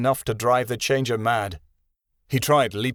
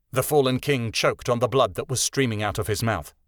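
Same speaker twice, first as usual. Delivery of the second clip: usual speaking voice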